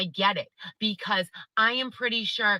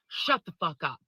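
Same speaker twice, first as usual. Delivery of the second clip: exasperated snap; 4 semitones lower than usual. Real, not acted. Reacting to smug testimony.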